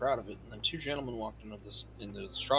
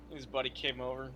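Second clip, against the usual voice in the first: ordinary talk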